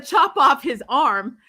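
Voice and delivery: normal talking voice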